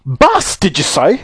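high, puzzled call; shouted back. A narrator's reading. Saucepan man mishearing from downhill, shouting back. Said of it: "shouted back the saucepan man".

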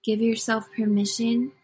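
measured meditation guide, long pauses between phrases; unhurried breath-counting cadence with lifted phrase endings; guided meditation. Real, not acted. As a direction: lead listeners through it in short, spaced phrases.

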